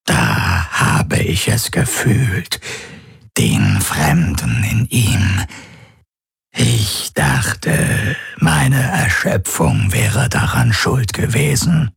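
slow, strange rasping whisper; a slow alien whisper; whispery creature voice. A narrator's reading. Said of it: "seine Flüsterstimme".

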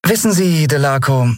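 high-pitched, disarmingly sweet; disarming smile. A narrator's reading. Young captain to the man trying to cheat her, disarmingly smiling. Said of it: "sagte sie mit entwaffnendem Lächeln".

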